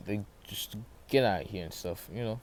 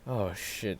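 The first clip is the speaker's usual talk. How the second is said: ordinary delivery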